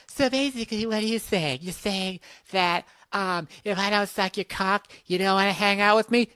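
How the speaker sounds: a hint of an indignant girl friend; mock girl. The girl friend challenging the caller, lightly high mock indignation.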